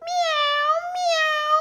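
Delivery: high cat meows. Acted. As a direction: yowl like a cat on the attack. Animals charge the robbers.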